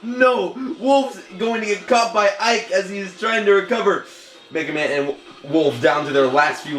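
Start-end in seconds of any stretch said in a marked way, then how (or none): 0.0-4.0 excited. Real, not acted. Wolf about to be caught.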